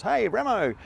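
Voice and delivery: mocking ribbing. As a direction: rib him about the wrong lake. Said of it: "the big joke every Christmas was".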